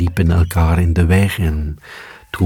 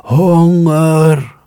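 long high louder howl; howling dog; 11 semitones higher, 5 dB louder than usual. A narrator's reading. Starving dog howling across the meadow, loud high wail. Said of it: "riep de hond".

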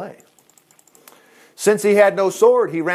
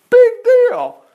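much higher, louder exclaimed Big deal; big deal shouted; 6 dB louder than usual. Shown as a demonstration. Preacher shouting Big deal to his listeners. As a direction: blast Big deal out high and loud. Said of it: "this was a big deal".

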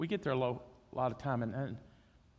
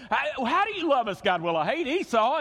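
mock exchange; 8 semitones higher, 5 dB louder than usual. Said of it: "think about that".

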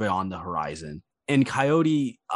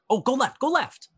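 high, urgent yelled directions; yelling directions. Acted. Coyote guiding her partner through the fleeing crowd, urgent yelling. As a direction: steer them through the crowd by shouting. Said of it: "she occasionally yells".

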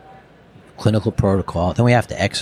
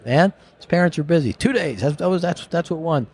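raised pitch, pushy bro imitation; high mocking buddy voice; 5 semitones higher than usual. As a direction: play the impatient buddy, pitched up. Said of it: "And Matt's like".

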